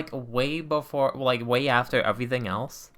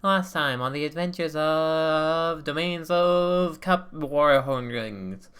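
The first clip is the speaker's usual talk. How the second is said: slow, grand announcer voice over music; announcer recap; 3 semitones higher, slower than usual. Acted. Opening the session recap like a TV announcer. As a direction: intone it like a TV series intro. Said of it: "hit us with a recap".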